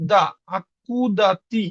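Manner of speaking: very slow আতকুদাতি, each sound drawn out; slow spelled demo. Shown as a demonstration. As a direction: stretch every syllable of আতকুদাতি. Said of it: "এটা উচ্চারণ হবে আ". (খুব ধীরে টেনে উচ্চারণ)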